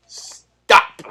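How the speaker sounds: loud, breathless shout; hoarse yell. Real, not acted. Vlogger shouting at cult-like voters to stop. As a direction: bark it to cut them off.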